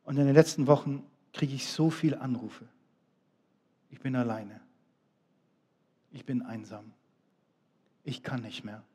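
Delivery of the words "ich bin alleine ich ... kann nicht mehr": soft, much quieter, sinking lament; lonely caller. Retold or imitated. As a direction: voice the lonely caller, sad and hushed. Lonely callers quoted on phone.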